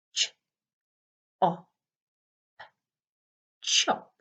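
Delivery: ch, op, then blend chop; sounding out words. Shown as a demonstration. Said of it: "it should look like this".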